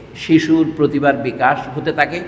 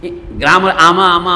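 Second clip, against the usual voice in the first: ordinary delivery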